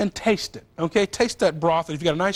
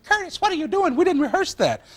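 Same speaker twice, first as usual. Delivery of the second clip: quoting Lauren in a much higher voice, teasing; mock Lauren voice; 12 semitones higher than usual. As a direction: squeak it out high like a surprised coworker. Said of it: "Lauren said".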